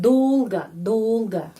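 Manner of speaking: dolga with the first syllable dolg stressed and the final a reduced; slow teaching demo of dolga, stress on the first syllable. Shown as a demonstration. Teacher showing Indonesian learners, slow stressed dolga. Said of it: "Di sini penekanan di sini".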